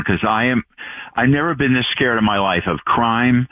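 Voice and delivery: ordinary talk, nothing marked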